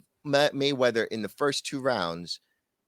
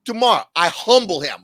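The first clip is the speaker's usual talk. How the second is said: loud, higher-pitched fighter's boast; Khabib; 4 semitones higher, 9 dB louder than usual. Retold or imitated. Retelling Khabib answering McGregor fans, loud mock boast. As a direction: declare it loudly at a booing crowd. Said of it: "Khabib's like".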